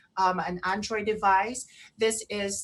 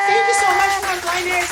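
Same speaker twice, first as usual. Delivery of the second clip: much higher, louder gushing thanks; excited thanks; 10 semitones higher, 10 dB louder than usual. Real, not acted. Host thanking frontliners on air, excited and loud. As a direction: pour out your gratitude.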